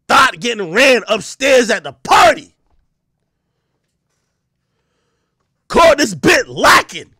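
loud hyped shouting of the video title; porn title announcer. Retold or imitated. Reading out favorite video titles, in a loud hyped shout. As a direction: announce it like a hype caption. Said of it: "I be like, yo".

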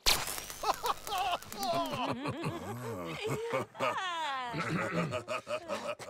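long, high giggling laugh; gleeful cackle. Acted. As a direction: cackle with delight at the chaos. Laughs during fight.

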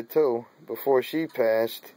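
ordinary delivery

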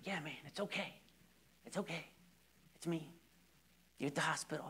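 hushed, reassuring comfort; softly calm a frightened friend; soothing. Retold or imitated. Calming a frightened patient.

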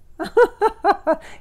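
a bright burst of high laughter. Real, not acted. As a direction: laugh out at the cat scene. Laughing at him petting cat.

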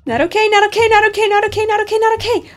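high, loud panicked chant. Real, not acted. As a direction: babble it in rising terror. Jump scare panic in game.

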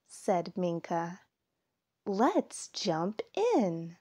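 lively storytime read-aloud; reading to children. A narrator's reading. Narrator reading a fall story to young listeners, storytime voice. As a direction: tell it like a picture book for little kids. Reads picture book aloud.

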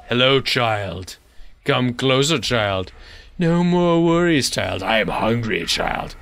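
a hint of a sinister old crone; old woman whispering. Demon uncle voicing creepy lines.

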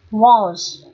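"walls" as full "woz", rounded O, no L; strong form, short O. Shown as a demonstration. Teacher rereading the three forms, strong rounded O in "walls". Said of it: "đọc mạnh kiểu khác này".

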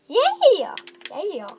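squeaky high mock yeah; imitating high-pitched voice. Gamer imitates his own squeaky high yeah. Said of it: "That was a very high-pitched voice".

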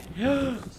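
gasp. Real, not acted. Gamer startled.

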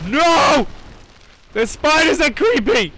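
high, loud frightened yell; loud scared no; panicked cry. Real, not acted. Panics at spiders.

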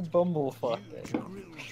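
slow drawn out. Real, not acted. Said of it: "So what happened was".

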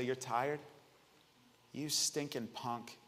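soft, deliberate tough talk to oneself; quiet self-scolding. Acted. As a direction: scold yourself under your voice in the mirror. Scolding himself in the mirror.

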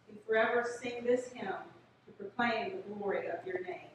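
ordinary talk, nothing marked

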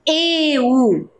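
"eu" said slowly and clearly; dictating "eu" for children to write; dictation. Shown as a demonstration. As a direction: dictate "eu" to the class. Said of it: "Vou repeti-la".

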